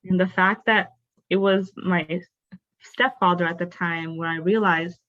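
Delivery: usual speaking voice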